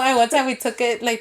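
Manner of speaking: ordinary talk, nothing marked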